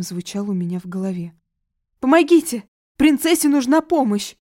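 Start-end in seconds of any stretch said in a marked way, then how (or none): 2.0-4.4 high, loud cry for help; shouting for help. Acted. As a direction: call out in alarm, higher and louder. Nanny calls for help.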